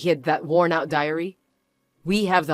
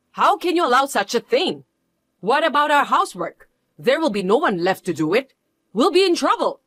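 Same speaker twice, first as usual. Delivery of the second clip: indignant protest; 6 semitones higher than usual. Acted. Mother-in-law protesting she'll lose her housekeeper, shrill outrage. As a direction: protest as if wronged and put upon.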